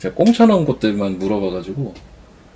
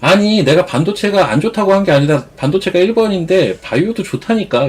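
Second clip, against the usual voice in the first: higher, louder exasperated insisting; insistent arguing; 7 semitones higher, 3 dB louder than usual. Retold or imitated. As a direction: argue back, getting worked up. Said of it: "이러면은".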